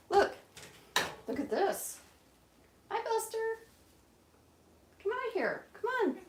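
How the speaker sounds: high pet voice. Real, not acted. Coaxing the pet out.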